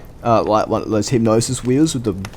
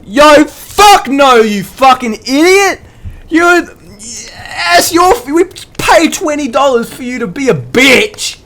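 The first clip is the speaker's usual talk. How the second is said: high, loud, exasperated yelling; outraged mum scolding; 14 semitones higher, 6 dB louder than usual. Retold or imitated. Imitating mum refusing Callum's second go, outraged shouting. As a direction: bellow a furious refusal, stretching the first words.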